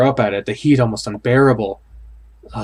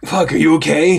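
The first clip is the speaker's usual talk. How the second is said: worried friend; 4 semitones higher, 3 dB louder than usual. Acted. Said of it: "muffled voice".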